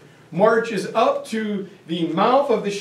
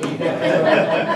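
breathy laugh; 3 semitones lower than usual. Real, not acted. Laughs at his own shirt joke.

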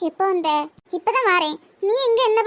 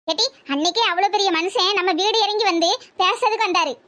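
normal talking voice

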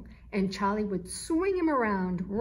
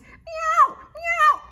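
loud meow; 12 dB louder than usual. A narrator's reading. Kitten answers louder.

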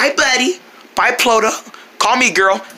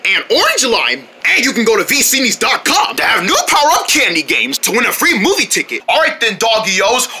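commercial read; 3 semitones higher than usual. Acted. Pretend TV commercial.

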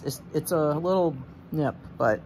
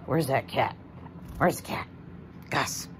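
ordinary delivery

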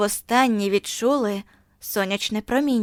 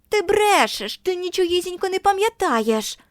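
put-on much higher, sharp accusing children's voice; interrupting taunt; 9 semitones higher than usual. A narrator's reading. Kids cutting off Margo, much higher and jeering. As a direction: cut her off, jeering. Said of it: "уривали вони дівчинку".

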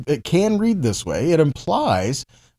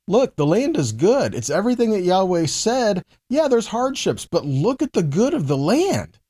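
bright, higher, selling the good land; lightly voiced hopeful spy; upbeat spy's report; 7 semitones higher than usual. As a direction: pitch the land as a prize. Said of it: "the emphasis changes when told that way".

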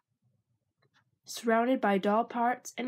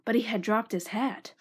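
usual speaking voice

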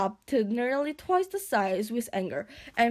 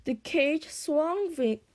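usual speaking voice

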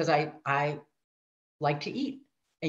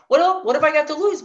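higher, louder, fast rush of thought; excited thought; 9 semitones higher, 9 dB louder, faster than usual. Retold or imitated. Thinking about joining, higher and louder with excitement. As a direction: toss it off with eager glee.

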